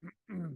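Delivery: clearing a hoarse throat. Real, not acted. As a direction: clear the throat before speaking. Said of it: "Sorry, I'm losing my voice".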